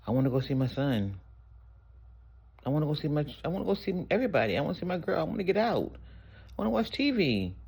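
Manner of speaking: rising tearful whine, near breaking; whiny crying. Retold or imitated. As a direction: wail it like a homesick kid sobbing into the pillow. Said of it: "and I laid there and I cried".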